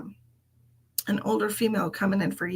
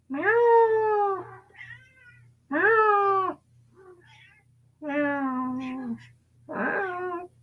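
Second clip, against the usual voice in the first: meowing; 10 semitones higher, much slower than usual. Pretend. Meowing back at the cat.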